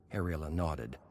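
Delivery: ordinary delivery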